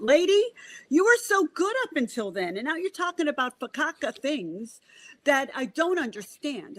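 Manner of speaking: high-pitched exasperated complaint, acted out; indignant client. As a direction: imitate a fed-up client, pitched well up. Imitating complaining client's daughter.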